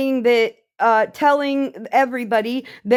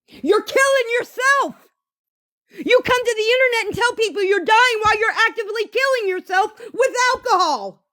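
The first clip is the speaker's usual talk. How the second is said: much higher heated scolding; exasperated outburst; 10 semitones higher, faster than usual. Real, not acted. Scolding Sunshine for drinking, high and heated. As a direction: call her out, incredulous.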